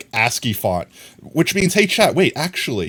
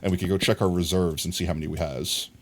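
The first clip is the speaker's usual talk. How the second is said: ordinary delivery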